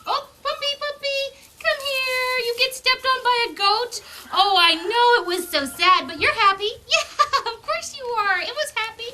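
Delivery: high sing-song praise to a puppy; high cooing puppy talk. Real, not acted. Baby talk to puppy stepped on.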